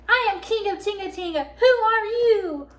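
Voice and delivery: loud, high kingly challenge, slowing on the question; mighty proclamation. A narrator's reading. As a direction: fill your lungs and boom it out. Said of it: "Lion took a deep breath".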